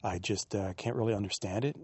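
normal talking voice

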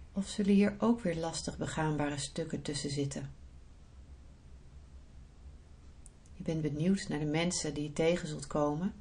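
soft, unhurried meditation voice; guided meditation. Acted. Leads a guided visualisation.

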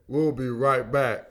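low, slow radio station ID read; station break announcer. Real, not acted. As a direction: read the station ID slowly, deep and measured.

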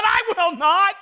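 loud, high, indignant retold shout; shouted refusal. Retelling reply to son's "hold her leg", shouted refusal.

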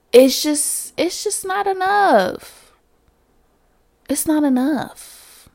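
high, slow lament; pained emphasis. Real, not acted. To viewers, a slow high lament. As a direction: drag it out in frustration. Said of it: "emphatic lament".

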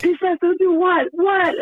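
high, loud disbelieving cry; loud, high what-the-heck outburst; incredulous. Real, not acted. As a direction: shout it in mock disbelief. Mock outrage at cut-off call.

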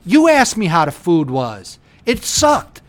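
loud, high ranting back-and-forth; mock heated argument. Acting out a blow-up with a nosy guest, loud and high. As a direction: blow up as if cornered.

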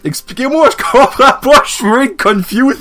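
high, whiny disbelief; exasperated whine. Real, not acted. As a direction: whine it like you're fed up. Exasperated plea for explanation.

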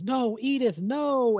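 anguished cry. Retold or imitated. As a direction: act out a father's horrified "no". Acted father's cry as daughter jumps.

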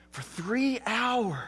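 slow, breathy hush. Real, not acted. Preacher hushing the congregation at the darkness, whispered. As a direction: let it sink to a whisper.

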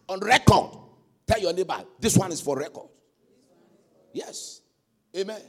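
ordinary talk, nothing marked

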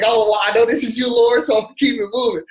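high, loud and laughing all through; laughing mock voice. Telling God he'll just keep walking, through laughter. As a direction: toss it off breezily while cracking up.

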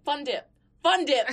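high, loud mock call to a friend. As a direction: holler a nickname across the table. Imagined calling friend at lunch.

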